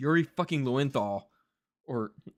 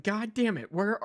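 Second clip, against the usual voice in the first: mock exasperated; 7 semitones higher than usual. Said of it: "It's like".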